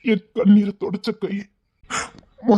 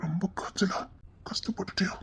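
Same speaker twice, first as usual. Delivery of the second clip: tender whisper; 13 dB quieter than usual. Acted. Tender worry over sick girl.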